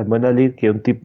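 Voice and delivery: ordinary talk, nothing marked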